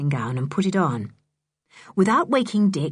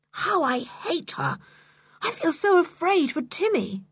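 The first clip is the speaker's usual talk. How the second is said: upset; 10 semitones higher than usual. A narrator's reading. George upset, hating and fearing.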